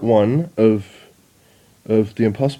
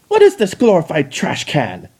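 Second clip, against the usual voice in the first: high, louder sneer; scornful exclamation; 5 semitones higher, 4 dB louder than usual. Acted. Chrysalis mocking the Dalek, high scornful sneer. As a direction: scoff at the machine. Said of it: "Chrysalis exclaimed".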